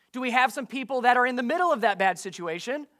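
normal talking voice

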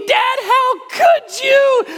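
put-on a child's drawn-out anguished wail of betrayal; wailing kid. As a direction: cry out at dad like a heartbroken kid. Kids sobbing, parents consoling them.

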